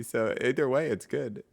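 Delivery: ordinary delivery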